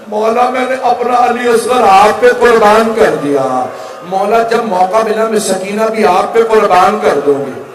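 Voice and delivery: loud, high, keening cry rising to its peak; wailing lament. A narrator's reading. Mother offering her children for her husband, high keening wail.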